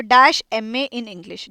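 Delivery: very slow, each word spaced out; slow dictation. Shown as a demonstration. Teacher dictating an example to students, very slow. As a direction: dictate it slowly for the class to copy. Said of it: "देखो example देखो".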